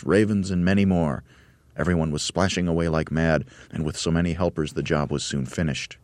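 usual speaking voice

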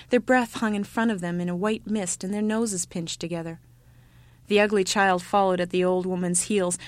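usual speaking voice

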